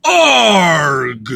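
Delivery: loud pirate "Arg" without the squeaky tail; pirate growl. Pretend. Practicing a better pirate sound, a loud growl. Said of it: "I think that was better".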